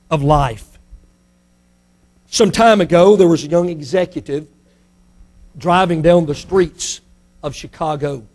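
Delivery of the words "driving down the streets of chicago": normal talking voice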